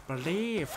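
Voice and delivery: "Believe" with a Scottish flick of the tongue. Shown as a demonstration. Streamer demonstrating Scottish sounds, tongue flicked in "Believe". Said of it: "flick the tongue off the roof".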